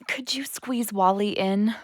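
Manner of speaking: hushed whisper so Dad's call isn't interrupted. Acted. Daughter whispering to her receptionist father while he's on the phone. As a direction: breathe the question under your breath.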